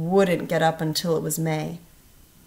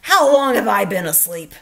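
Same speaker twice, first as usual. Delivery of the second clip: Toad; 7 dB louder than usual. A narrator's reading. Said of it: "said Toad".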